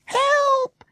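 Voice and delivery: high piercing shriek for help. Acted. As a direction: scream it out, panicked and loud. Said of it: "shrieked Jessica".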